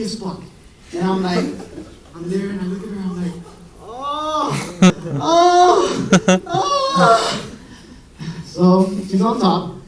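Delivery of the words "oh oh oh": rising, higher and louder ohs, climbing each time; mock moaning. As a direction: ham up a mock climax in three rising ohs. Mock moaning wife scene.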